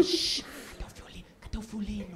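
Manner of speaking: urgent whisper after a shush; hushed secret demand. Acted. Asking for the violin while covering her eyes, whispered. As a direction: whisper so only the waiter hears. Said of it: "E falando baixinho no ouvido do cara".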